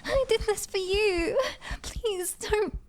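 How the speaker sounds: high-pitched, desperate crying plea; tearful pleading. Acted. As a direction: beg through tears for them to stay. Desperate plea to departing brother.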